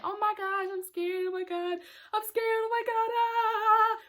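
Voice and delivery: high-pitched panicky fretting; mock scared. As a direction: fret high, stretching the last cry. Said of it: "I was kinda scared".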